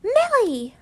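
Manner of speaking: high, loud exclamation of a name; scolding. A narrator's reading. Pansy telling off her rude friend Millie, scolding.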